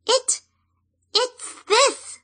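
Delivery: very high, tiny squeaking voice; squeaky fairy. Acted. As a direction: squeak it out like a frightened sprite. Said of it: "squeaked the fairy".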